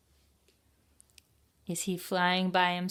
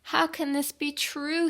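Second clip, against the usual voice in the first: sad Penguin; 10 semitones higher than usual. Acted. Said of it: "thought Penguin".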